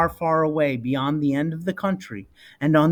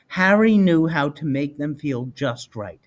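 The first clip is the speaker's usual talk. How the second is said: usual speaking voice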